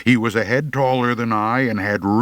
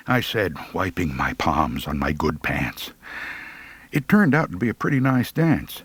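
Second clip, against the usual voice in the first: ordinary delivery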